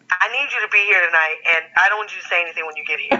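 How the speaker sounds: fast, blunt demand; quick no-nonsense order; rushed demand. Retold or imitated. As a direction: rattle it off quickly.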